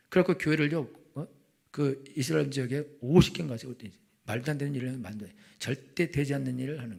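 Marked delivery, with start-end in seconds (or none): none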